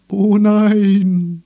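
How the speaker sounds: loud, high wail; long-held cry of dismay; dismayed chorus. A narrator's reading. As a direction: wail back in alarm. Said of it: "bekam er zurück".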